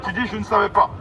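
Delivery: usual speaking voice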